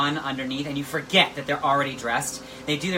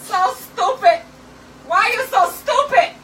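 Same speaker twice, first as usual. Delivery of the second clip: mock shriek; 9 dB louder than usual. Mock yelling at self.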